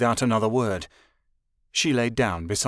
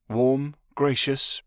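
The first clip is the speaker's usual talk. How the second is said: ordinary delivery, nothing marked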